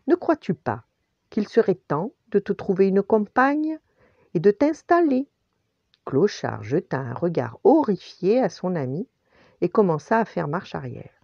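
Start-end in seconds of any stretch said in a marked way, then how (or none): 0.1-5.3 higher, louder rising question; teasing suggestion. A narrator's reading. Tony nudges his dog friend to settle, teasing. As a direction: float the idea with playful insistence.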